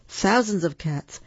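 ordinary delivery

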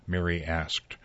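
usual speaking voice

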